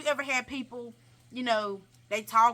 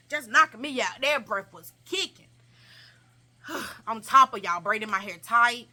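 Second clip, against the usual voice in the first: usual speaking voice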